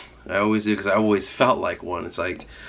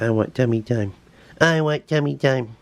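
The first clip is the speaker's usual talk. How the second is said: put-on pet voice, rising higher on the repeat; pet's imagined voice; 4 semitones higher than usual. Owner voicing pet begging for tummy rub, playful character voice. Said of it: "She's like".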